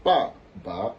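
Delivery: first ba falling, second ba rising; ba ba, falling then rising; second tone on second syllable. Shown as a demonstration. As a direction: drop the first "ba", lift the second "ba". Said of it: "Ça, ça va donner".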